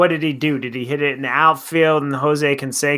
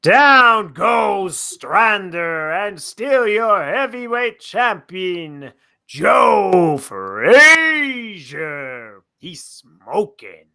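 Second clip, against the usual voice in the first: slow, drawn-out ringside call, higher and louder; a slow dramatic fight call; boxing announcer impression; 5 semitones higher, 5 dB louder, much slower than usual. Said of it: "impression is usually".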